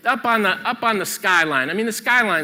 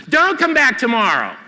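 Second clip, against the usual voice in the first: loud, high, forceful command; emphatic admonishment; 7 semitones higher, 6 dB louder than usual. Real, not acted. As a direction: scold them out of putting it off. Emphatically rebuts the quoted procrastinator.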